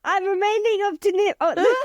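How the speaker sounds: high, loud, cracking up mid-word; breaking into laughter. Real, not acted. Co-host fluffing own catchphrase, breaking up laughing. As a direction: start bright and high, collapse into laughter.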